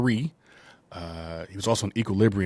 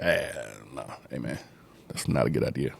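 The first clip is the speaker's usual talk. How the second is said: much lower put-on voice, cautioning; deep warning friend; 7 semitones lower than usual. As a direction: drop the voice low and warn him off. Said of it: "I don't know why I'm talking, talking like that".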